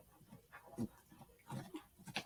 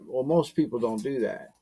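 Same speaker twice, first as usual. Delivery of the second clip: ordinary talk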